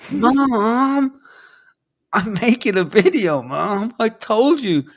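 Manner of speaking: high, loud mock yell of a frustrated kid; shrill kid yelling at his mother, imitating; whiny scream at mom. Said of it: "he wants to scream".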